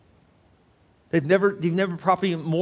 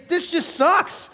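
pitched up high, a laugh breaking into the lament; venting the hurt; 11 semitones higher, 3 dB louder than usual. Retold or imitated. Preacher voicing a hurting churchgoer's lament. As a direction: let it all out, higher and louder. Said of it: "a moment where you just say".

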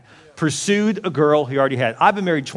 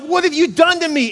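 outraged; 13 semitones higher, 3 dB louder than usual. Pretend. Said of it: "He's like".

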